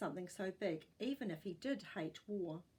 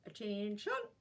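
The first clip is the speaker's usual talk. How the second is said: bellowing. A narrator's reading. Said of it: "When he bellowed".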